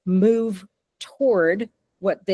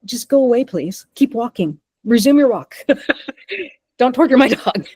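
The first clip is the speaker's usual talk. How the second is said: retold shooing yell; 5 semitones higher, 4 dB louder than usual. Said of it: "I've had people stop and stare".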